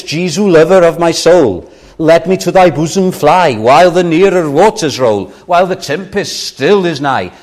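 reciting hymn. Acted. Preacher quoting a hymn to the congregation, fervent. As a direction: declaim the hymn with feeling.

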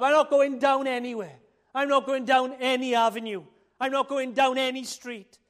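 firm, loud, emphatic no; loud refusal. Acted. Preacher firmly refuses the invitation.

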